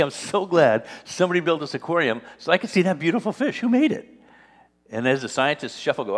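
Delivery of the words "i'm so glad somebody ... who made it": gushing, rising to a high excited question; mock wonder. Visitor at aquarium asking who made the fish, gushing. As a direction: marvel at the fish like a delighted visitor.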